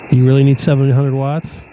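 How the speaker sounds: normal talking voice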